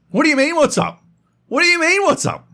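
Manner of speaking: very high, loud disbelieving outburst; incredulous retort. Retold or imitated. Answering the neighbour's casual greeting, high loud disbelief. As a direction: fire back in outraged disbelief. Confronting noisy upstairs neighbour.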